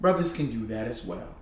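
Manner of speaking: ordinary talk, nothing marked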